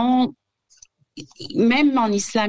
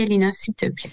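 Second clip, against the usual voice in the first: ordinary talk, nothing marked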